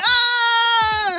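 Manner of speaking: high shrieking ah; high mock squeal, like a smitten schoolgirl; mock girlish squeal. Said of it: "You didn't say".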